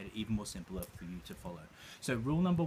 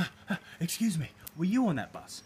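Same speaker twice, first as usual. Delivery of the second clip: frantic asking; 6 semitones higher, 5 dB louder than usual. Retold or imitated. Client asking strangers more desperately.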